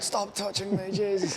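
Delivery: high-pitched imitation of fed-up pleading; mock exasperated protest. Sunburnt girl to the kids poking her, exasperated.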